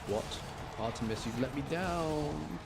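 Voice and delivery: ordinary talk, nothing marked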